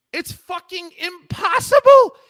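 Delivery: high, loud shout breaking into a cry; real exasperated shout. Real, not acted. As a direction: yell it out in sheer frustration, voice cracking. Ranting about proving residency.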